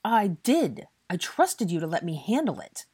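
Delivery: loud, high, emphatic retort; heated defence; angry insistence. A narrator's reading. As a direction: insist on it hotly. Draco snaps back.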